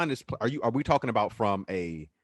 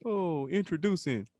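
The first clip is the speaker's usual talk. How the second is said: booming slow announcer voice; arena announcer; 9 semitones higher, 4 dB louder, slower than usual. Retold or imitated. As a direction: announce it like the finals PA. Mock arena announcer intro.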